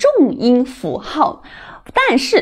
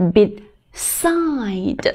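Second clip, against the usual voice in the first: "beside" very slow, with the "side" part long and stressed; stress on second syllable; 4 dB quieter than usual. Shown as a demonstration. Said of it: "后面这个部分读得重一点".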